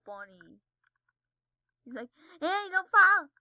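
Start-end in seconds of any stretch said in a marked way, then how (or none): 2.4-3.3 high, very loud mock yell; imitated warning shout. Repeating the video's funny line, imitated warning shout. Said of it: "the way she says it is just funny".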